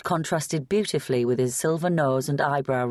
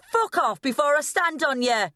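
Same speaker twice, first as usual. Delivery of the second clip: angry shout; 12 semitones higher than usual. Acted. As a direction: shout them off, furious. Kerry threatens with raised bottle.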